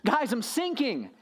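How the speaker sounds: hammed-up sinking man begging his friends; mock panicked pleading. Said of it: "look back at his friends and say".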